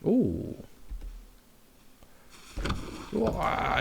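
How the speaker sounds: high startled cry; startled gasp. Real, not acted. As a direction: jump at a sudden scare. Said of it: "ils m'ont fait peur".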